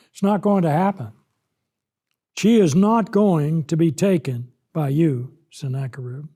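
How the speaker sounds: ordinary talk, nothing marked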